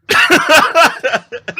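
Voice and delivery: high wheezing laugh; cracking up at the memory; burst of laughter. Real, not acted. Laughing while telling friends the jail story.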